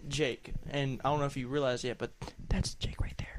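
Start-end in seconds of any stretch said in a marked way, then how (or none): 2.5-3.4 hushed aside, just breath; whisper. Real, not acted. Whispering to listeners that the guest is Jake. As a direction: lean in and whisper the reveal.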